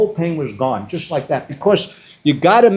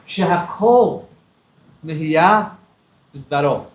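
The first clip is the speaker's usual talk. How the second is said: slow, halting blessing with long pauses; a touch of tears, each word set apart; tearful blessing; 2 semitones lower, slower than usual. Retold or imitated. Grandfather in the stranded boat says a blessing, tearfully. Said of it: "I had tears in my eyes".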